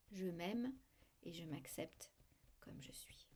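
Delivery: soft, dying away into a whisper; gentle mantra fading into a whisper. Shown as a demonstration. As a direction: let the affirmation fade to nothing. Self-acceptance affirmation after tapping.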